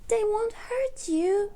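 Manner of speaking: high-pitched little storybook voice; reassuring character voice. Acted. Peppa reassuring scared Richard, high gentle character voice.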